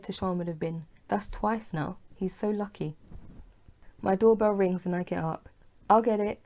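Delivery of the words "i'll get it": called out loud to the door; shouting. A narrator's reading. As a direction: shout it down the stairs. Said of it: "Leah shouts".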